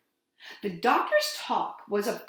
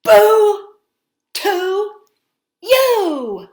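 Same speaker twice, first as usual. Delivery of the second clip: slow, loud, higher "Boo to you"; bold boo; 11 semitones higher, 14 dB louder, much slower than usual. Shown as a demonstration. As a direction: stare it down and boom each word. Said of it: "Let's practice that all together".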